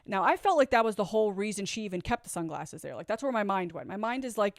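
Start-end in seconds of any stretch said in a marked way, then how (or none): none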